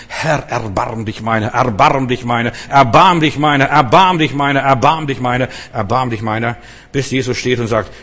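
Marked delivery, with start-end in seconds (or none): 0.1-6.6 repeated plea swelling louder, then easing back; crying out for mercy. Shown as a demonstration. Blind beggar crying out to Jesus, swelling plea. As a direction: cry it out again and again, building then falling. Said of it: "du solltest so lange schreien".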